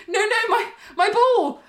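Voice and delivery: high-pitched mock child's protest; high, insistent little-kid plea; pleading toddler. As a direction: lightly imitate a small child pleading. Retold toddler pleading at door.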